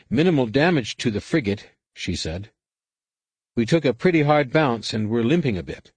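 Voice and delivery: a hint of an alien subordinate giving a report; Hasishi, alien crew officer. A narrator's reading. Hasishi's reports, voiced higher.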